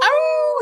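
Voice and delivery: high loud wolf howl; mock howl. Kids howling at the substitute teacher, high and loud. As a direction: howl like a rowdy kid.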